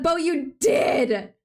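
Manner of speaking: slow, drawn-out, loud teasing; laughing callout. Real, not acted. Streamer teasing Bo in chat, loud and slow. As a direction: stretch it out to tease Bo.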